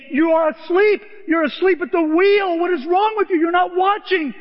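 high, loud berating shout; urgent full-voiced rebuke. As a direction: shout as God rousing a sleeper. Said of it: "he shouts because he".

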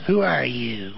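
low, suspicious mother at the door; George's mother doesn't know him, low and wary; lightly voiced cold old mother. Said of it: "he goes".

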